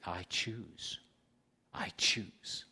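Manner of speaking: soft whispered repetition. Real, not acted. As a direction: whisper it to yourself.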